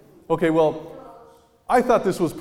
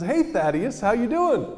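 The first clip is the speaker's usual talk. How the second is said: high, bright, loud greeting of a woman; friendly jogger; 11 semitones higher, 5 dB louder than usual. Retold or imitated. Jogging woman greeting him as Thaddeus, high and friendly. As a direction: call out cheerily like an old friend.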